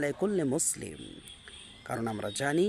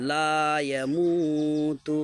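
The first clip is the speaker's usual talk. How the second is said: slow chanted recitation, vowels drawn long; Quran recitation; 5 dB louder, much slower than usual. Acted. Teacher chanting the verse for the class. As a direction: recite it melodically and slowly.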